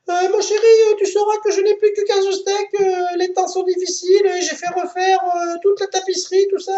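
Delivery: very high falsetto woman; high wife voice. Acted. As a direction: squeak like the wife. Wife answers on phone.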